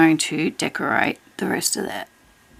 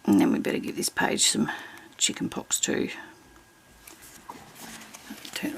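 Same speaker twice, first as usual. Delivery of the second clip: whispering; 5 semitones lower, 7 dB quieter than usual. Real, not acted. Quiet colouring narration.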